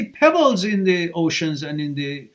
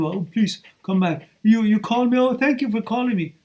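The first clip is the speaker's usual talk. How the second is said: higher-pitched imitation of begging; mock begging; 7 semitones higher than usual. Coach imitating guys begging an ex back, mock pleading. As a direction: plead like a grateful doormat, mockingly. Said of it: "shouldn't be begging".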